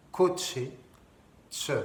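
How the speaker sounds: "coche" with CH said "tch". Shown as a demonstration. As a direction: put a T into the CH of "coche". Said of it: "C'est comme un T C H". (« coche » prononcé avec « tch »)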